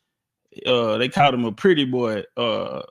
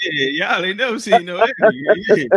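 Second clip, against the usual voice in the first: mock taunt; 6 semitones higher, 6 dB louder than usual. Acting out Roots characters.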